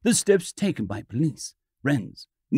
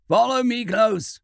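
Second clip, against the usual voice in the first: stern; 8 semitones higher than usual. A narrator's reading. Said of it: "said the old man sternly".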